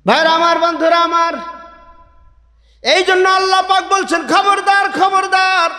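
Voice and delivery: high, impassioned sermon delivery; fiery waz preacher calling out; preaching at full force. Acted. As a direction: preach it out to the whole gathering. Preacher calls the audience.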